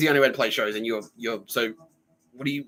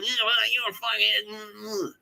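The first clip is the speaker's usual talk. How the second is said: mock drunk; 11 semitones higher, much slower than usual. Drunk bandmate still ranting.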